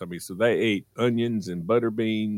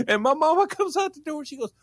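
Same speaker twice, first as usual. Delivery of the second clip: laughing while telling; faster than usual. Real, not acted.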